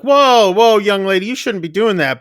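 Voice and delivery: loud, high "whoa" easing into a reprimand; imitated adult telling-off; startled scolding. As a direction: halt a child with mock parental alarm. Adults scold child in retelling.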